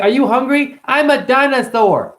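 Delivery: put-on high, loud, slow childish voice; playful child proudly announcing he's a dinosaur; little kid voice. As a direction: squeal it like a small kid. Voicing child in kid's exchange.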